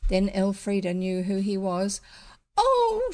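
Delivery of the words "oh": sharp high cry, much higher and louder; a girl's hurt outcry; cried out. A narrator's reading. Elfrida cries out at the highwayman, high and hurt. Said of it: "she cried".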